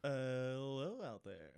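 a hint of a smooth radio DJ; slow easy radio host greeting; Casey Kasem voice. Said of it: "Going for the Casey Kasem".

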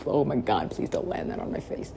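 ordinary delivery

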